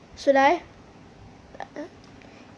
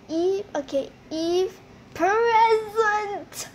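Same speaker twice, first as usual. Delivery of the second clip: normal talking voice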